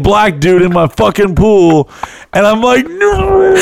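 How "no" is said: a very high, strained no; panicked no. Retold or imitated. Pulled underwater by Henry, squeaking a high panicked no.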